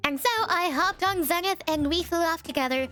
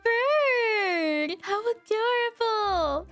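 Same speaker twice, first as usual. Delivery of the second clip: cooing; 6 semitones higher, slower than usual. Real, not acted. Cooing at cute bird.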